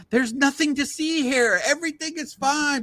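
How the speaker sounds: mock cop-like "move along" from a blissfully positive fan; mock cheerful fan. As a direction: parody unbothered fans brushing it off. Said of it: "positive Dodger fans who just think".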